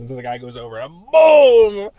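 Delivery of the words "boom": loud drawn-out imitated impact; booming punch noise. Storyteller showing a guy getting punched, loud boom. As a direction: punch it out as a sound effect.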